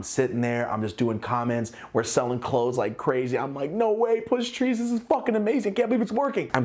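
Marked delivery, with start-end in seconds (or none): 3.7-6.5 high, excited disbelief; high excited squeal of delight; thrilled. Retold or imitated. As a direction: gush with joy over the news. Thrilled at brand success.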